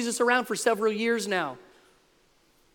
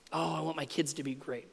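low, hushed mock-wistful parent; quietly imitating a parent's wish; soft parental wish; 4 semitones lower, 11 dB quieter than usual. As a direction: sigh it out softly like a hopeful mom. Voicing what parents wish.

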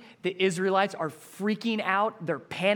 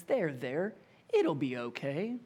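mock soothing, softer and lower; hollow comfort; 4 semitones lower, 7 dB quieter than usual. A friend offering hollow comfort to Petra, mock soothing. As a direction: pat them on the head with empty words. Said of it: "that's not helpful at all".

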